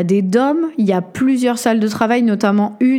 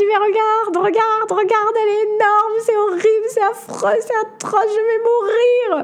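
much higher, hammed-up terrified wail; retold panic; 12 semitones higher than usual. To the guide, pointing at the tarantula, in a hammed-up terrified wail. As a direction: act out the spider panic, near tears. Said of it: "Et je dis".

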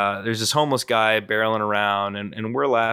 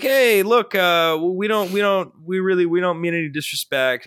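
placating; 7 semitones higher than usual. Retold or imitated. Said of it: "I was like".

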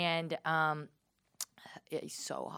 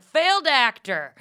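loud, high, drawn-out shout; yelled out heckle; 9 semitones higher, 13 dB louder, slower than usual. Retold or imitated. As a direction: holler it out across the acting class. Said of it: "I just yelled out".

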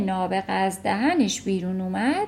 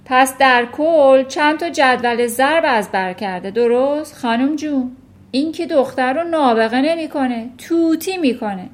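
loud, raised dismissive voice; scornful shout; 4 semitones higher, 8 dB louder than usual. A narrator's reading. Headmistress belittling the girl's times tables, scornful shout. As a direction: shout down the praise. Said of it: "فریاد زد".

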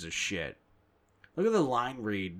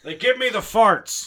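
much louder, higher Ford, laughing; Harrison Ford impression; 10 semitones higher, 11 dB louder than usual. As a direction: bellow it through a laugh. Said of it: "you have to do Harrison Ford loud".

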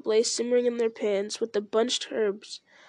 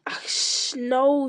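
mildly raised, agitated cry; 3 semitones higher, much slower than usual. A narrator's reading. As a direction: cry it out in mild alarm, a little higher. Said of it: "he cried".

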